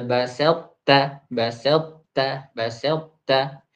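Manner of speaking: basal ta said slowly, ta kept thick and heavy; slow Quranic drill of basal ta; slow drilled recitation. Shown as a demonstration. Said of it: "Jadi, eh".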